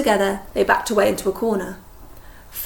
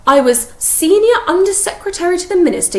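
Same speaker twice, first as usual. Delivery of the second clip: higher, louder, prissy lady voice; prim boast; 10 semitones higher, 8 dB louder than usual. Acted. Umbridge to Hagrid, high prim boasting voice. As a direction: show off your rank sweetly.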